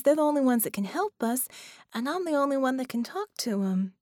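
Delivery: put-on a small girl finding her courage; young girl voice. Child Penny voiced, higher pitch.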